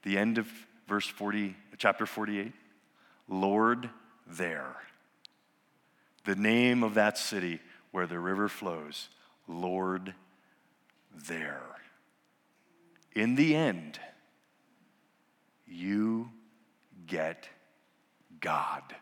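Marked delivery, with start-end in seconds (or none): 3.3-11.8 hushed reverence. Acted. Soft repeated name of city. 13.1-19.0 fading from low voice to a whisper on God. Acted. Pastor to congregation, fading into a whisper.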